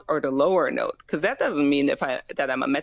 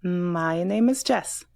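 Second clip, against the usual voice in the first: long M on "My", slow lilt to "Jess"; half-sing "My name is Jess", falling at the end; sing-song speech; slower than usual. Shown as a demonstration. Student copies coach, slow sing-song "My name is Jess". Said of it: "kinda sing-song speak the rest".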